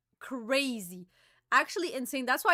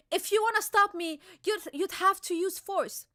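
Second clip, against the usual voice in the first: higher, louder, defiant challenge; Roger's challenge; 8 semitones higher, 3 dB louder than usual. Retold or imitated. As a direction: dare them to stop you. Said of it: "Roger's quote in the scene".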